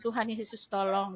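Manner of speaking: soft, faint plea to God; barely above a murmur, begging for help; weak pleading prayer. Retold or imitated. Sick mother softly begging Jesus for help, faint and weak. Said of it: "Saya cuma ngomong gitu".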